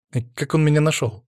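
plain reading voice

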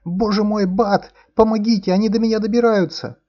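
high, loud terrified shriek; piercing scream. Acted. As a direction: scream for help in panic. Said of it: "пронзительным криком".

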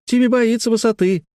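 put-on very high sing-out child voice; taunting little girl. A narrator's reading. Sister mocks brother's fear of heights, high taunting voice. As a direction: tease like a little sister, pitched right up. Детская перепалка, сестра дразнит.

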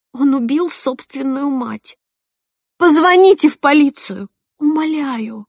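high, pleading cry; desperate begging. Acted. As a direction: implore them to believe you.

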